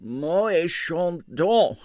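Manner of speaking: very slow, savoured announcement; happy. A narrator's reading. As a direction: savour the name happily. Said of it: "he announced happily".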